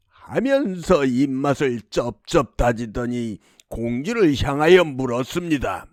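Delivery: ordinary delivery, nothing marked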